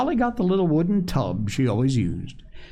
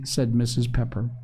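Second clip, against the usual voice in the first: ordinary delivery, nothing marked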